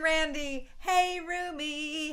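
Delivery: slow, high sing-song "hey, Rumi"; drawn-out greeting. Real, not acted. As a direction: stretch out "hey" like a playful call. Playful greeting, then repeated.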